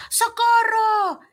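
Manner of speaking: high, slow playful scream; mock scared shout, high and drawn out; childish cry for help. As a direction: shriek it like a scared kid at play.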